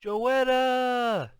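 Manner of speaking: high, loud, drawn-out yell; full-voiced call, stretched out; father yelling. A narrator's reading. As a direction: yell the name like a frantic parent searching. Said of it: "It looked like Dad's mouth was yelling".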